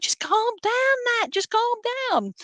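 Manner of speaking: high squeaky imitation of a frazzled friend; mock high-pitched plea. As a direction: play someone begging her to calm down, voice way up. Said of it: "And it's like".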